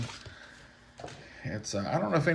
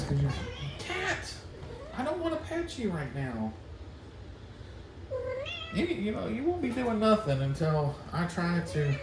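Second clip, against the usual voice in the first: much higher sing-song voice for the cat; pet talk; 10 semitones higher, 3 dB quieter than usual. Real, not acted. Telling the cat Phoebe to move, in high pet talk. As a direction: coo at a pushy cat while you work.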